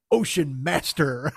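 low, slow grandiose delivery; hammed-up villain naming himself, slow; mock-grand title. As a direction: intone it like an epic title. Shark king names himself.

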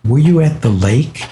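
pointed question. Retold or imitated. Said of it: "he looked right into my eyes, and he said".

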